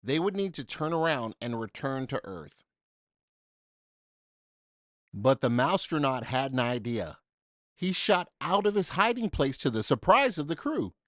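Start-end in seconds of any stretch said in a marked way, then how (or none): none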